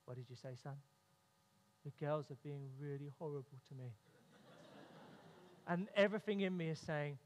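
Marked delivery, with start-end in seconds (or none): 0.0-0.8 softer, lower question; gentle low ask. Retold or imitated. Father kneeling to his son, asking softly. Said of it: "come down to his level". 1.8-3.9 put-on soft, low small-boy voice; cute quiet mode. Son repeating his complaint to dad, soft and low. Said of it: "cute, quiet mode".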